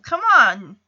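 usual speaking voice

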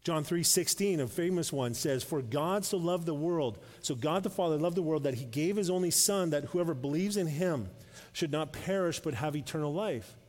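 loud, high proclamation; preaching at full force. Acted. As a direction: lift the verse over the room.

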